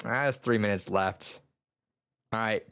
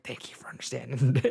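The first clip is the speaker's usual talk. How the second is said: whispered thanks; 3 semitones higher than usual. Retold or imitated. Teacher whispering thanks to students.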